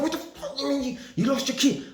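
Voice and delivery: furious high shout; going mad. Retold or imitated. Tenant loses her key on Christmas night, furious shout. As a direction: yell down the phone in disbelief. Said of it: "I was going mad".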